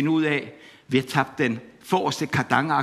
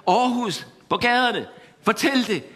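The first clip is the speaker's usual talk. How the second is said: urgent call; 6 semitones higher than usual. Acted. Preacher urging the city.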